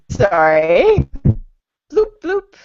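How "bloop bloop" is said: robot voice. Pretend. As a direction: play a beeping computer.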